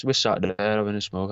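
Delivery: normal talking voice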